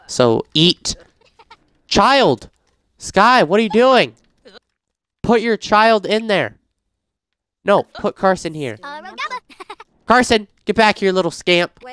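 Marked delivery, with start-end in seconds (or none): 1.9-11.1 exasperated call. Real, not acted. Yelling at sim to fetch child.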